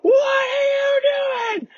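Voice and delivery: mock panicked scream of the woman at the pump. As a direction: shriek it in hammed-up panic. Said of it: "started screaming".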